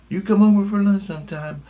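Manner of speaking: high put-on voice of a neighbour; inviting neighbour. Voices neighbours inviting him over.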